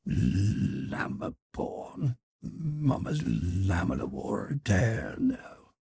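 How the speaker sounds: put-on soft, slow murmur to a baby; hushed, drawn-out mother's comforting; soothing murmur. A narrator's reading. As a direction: croon gently to calm a crying child. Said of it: "and murmuring".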